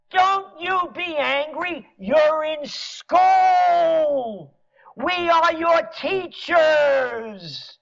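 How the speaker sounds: high, very slow patronizing teacher voice; mock schoolteacher. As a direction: drag it out like a condescending teacher scolding kids. Mock teacher scolding citizens like pupils.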